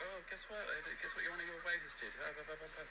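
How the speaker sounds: high, babbling jolly-woman imitation; jolly chatterer. Waiter imagines guest gossiping about him, jolly mock chatter. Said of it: "she's so jolly. She'd be like".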